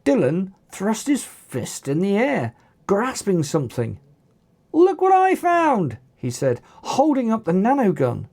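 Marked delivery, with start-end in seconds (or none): 4.7-6.0 high, excited announcement; triumphant call. A narrator's reading. Dylan holds up the nanogun to everyone, triumphant call. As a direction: crow about the find, pitched high. Triumphantly shows the found gun.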